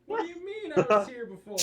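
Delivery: high, loud, outraged question; mock indignant shout. Real, not acted. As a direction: yell it in fake outrage.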